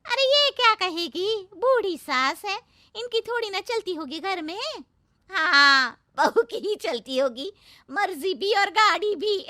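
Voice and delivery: high, loud taunting; mocking village women. Neighbours taunt the mother-in-law, high and loud. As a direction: rub it in that the old woman has no say.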